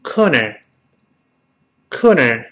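corner slowly, curled R only at the end; final R only. Shown as a demonstration. Teacher has class repeat corner, slow American model. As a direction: say corner with plain 'co', then curled 'ner'. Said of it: "后面卷舌".

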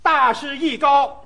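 couplet recital. Acted. Reciting the first couplet line.

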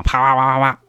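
rapid-fire "pa-pa-pa" sound effect; imitated stabbing blows. Storyteller mimicking Jeff's repeated knife blows, rapid-fire. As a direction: rattle off the hits like a burst.

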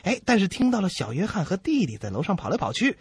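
ordinary delivery

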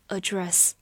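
"address" with a weak first vowel, uh-DRESS; "address" as the verb, stress on DRESS; "address" with schwa first, second syllable stressed. Shown as a demonstration. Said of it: "只能读作address".

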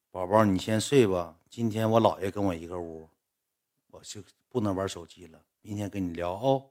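low, quiet, trailing words to a sweetheart; soft goodnight coaxing. Acted. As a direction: murmur a sleepy goodnight excuse. Dictating a goodnight text to girlfriend.

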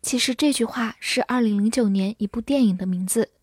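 ordinary delivery

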